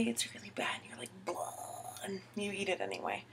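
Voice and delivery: soft whispered confession; whispering a failed-bake confession. Real, not acted. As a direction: let it drop to a hush, like a secret. Whispered confession of bad cupcakes.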